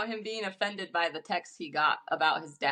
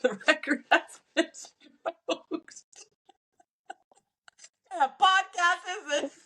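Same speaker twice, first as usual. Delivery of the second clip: laughing; 9 semitones higher than usual. Real, not acted. Giggling at their own joke.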